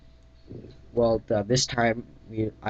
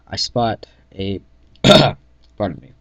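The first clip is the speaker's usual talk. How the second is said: ordinary delivery, nothing marked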